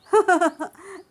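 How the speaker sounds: high, loud manic laugh; crazed laugh. Acted. Shweta laughing madly at Abhi, high and loud. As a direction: break into an unhinged cackle.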